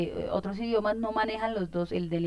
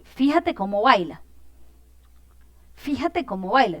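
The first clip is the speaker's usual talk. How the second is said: exclamation; 4 semitones higher, 6 dB louder than usual. Shown as a demonstration. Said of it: "estoy transmitiendo como una emoción".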